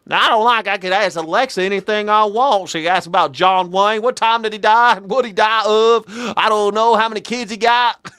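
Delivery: high, loud hillbilly impression; mock country old-timer accent. Host imitating the man asking Alexa, loud country accent. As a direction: exaggerate the old man's country twang loudly. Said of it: "speak in that type of accent".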